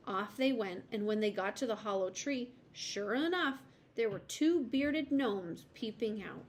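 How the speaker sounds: usual speaking voice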